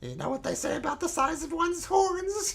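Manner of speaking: very high squealing boast; bragging goatling. Acted. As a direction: crow at the rival in a hammed-up squeal. Goatling brags about horns.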